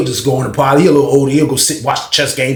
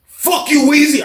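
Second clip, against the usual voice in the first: angry snap; 12 semitones higher, slower than usual. Retold or imitated. Banks snapping at narrator.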